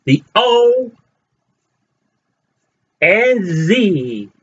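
high, showman's presentation with long pauses; grand announcer reveal. Acted. Vlogger unveiling his new Oz items to fans, grand announcer voice. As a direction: unveil it like a ringmaster.

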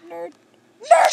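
high, loud yelled nerd; shrieking taunt at the game; shouted taunt. Real, not acted. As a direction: yell it like a taunt.

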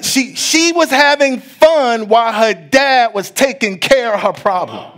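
high-pitched rising preacher's cadence; preaching. Acted. Preacher urging the congregation to trust God, preaching hard. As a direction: drive the point home to the congregation. Preaching builds to full force.

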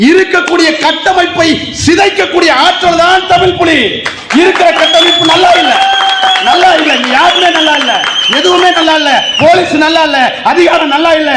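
high, ringing rally denunciation; impassioned oratory. Real, not acted. Activist tells a rally the system is rotten, impassioned.

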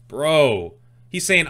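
ordinary talk

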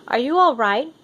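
lady calling back to Missus Kim. Acted. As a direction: call out to someone trailing behind. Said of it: "she calls".

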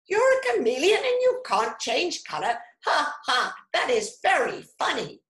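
high, loud, fast mocking squawk; rapid, taunting parrot voice. A narrator's reading. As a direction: jeer like a laughing parrot. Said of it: "squawked Parrot".

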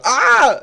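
short, high-pitched mock scream; loud "Ah!", mimicking a startled scream; imitated scream. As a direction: let out a quick retold shriek, high and loud. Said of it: "she, like, kinda screamed".

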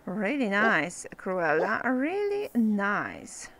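low, dry sarcastic praise, slow at the end. Acted. Player mocking Cruella for overfeeding the puppy, sarcastic. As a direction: drawl the compliment you don't mean.

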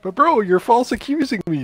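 upset protest. Real, not acted. Accused player protests angrily.

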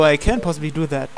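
ordinary talk, nothing marked

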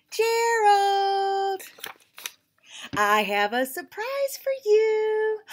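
high, slow, sweet pig voice; Piggy voice. Acted. Piggy tells her friend Gerald about a surprise, high and teasing. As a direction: play Piggy, teasing with a secret.

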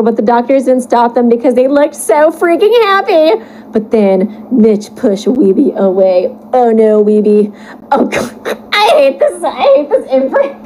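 usual speaking voice

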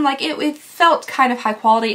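usual speaking voice

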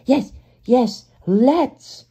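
high, loud, eager little engine; Percy. A narrator's reading. Percy jumps at the chance to race, excited. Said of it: "said Percy".

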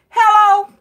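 high, loud, stern mama's hello; mama answering. Retold or imitated. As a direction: boom out hello like an annoyed mother. A boy's mama answers the phone.

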